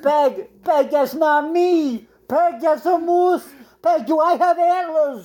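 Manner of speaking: high, slow desperate plea; pleading husband. Acted. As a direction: beg your wife to see the difference. Pleads with his wife.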